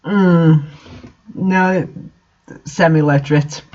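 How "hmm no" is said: ordinary talk, nothing marked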